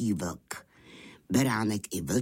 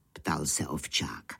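plain reading voice

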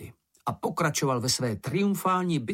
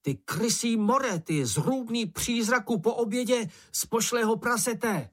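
taunting insult; 10 semitones higher than usual. A narrator's reading. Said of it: "pokračoval".

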